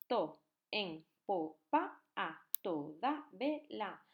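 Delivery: chopped into slow separate syllables. Shown as a demonstration. Teacher splitting the verse into syllables for the class. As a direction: break the words into their syllables. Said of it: "esto es un diptongo".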